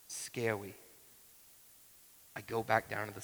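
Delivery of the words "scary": quiet "scary" said like "skeh-wy"; lightly imitated small-child "scary", R softened to W; toddler's soft R. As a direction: say "scary" softly with a W for the R. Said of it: "she can't really say ours".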